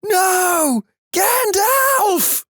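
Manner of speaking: very high, wailing cry; anguished cry. A narrator's reading. Ex-roommate crying out in his sleep, anguished wail. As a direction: wail it out high in hammed-up despair. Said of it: "he cried out".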